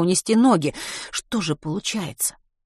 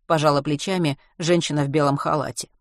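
usual speaking voice

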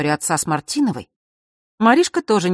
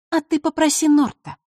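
snide; 12 semitones higher than usual. A narrator's reading. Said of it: "ехидно отозвалась Василиса".

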